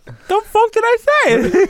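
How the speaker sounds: high, loud, drawn-out protest; hammed-up indignant shout; mock outrage. Mock outrage at being told off.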